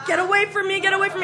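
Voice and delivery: high, panicky pleading; imitated frightened victim. Cornered star pleading with the scarer, mimicked panic. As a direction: mimic someone begging to be left alone. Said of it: "And she's just like".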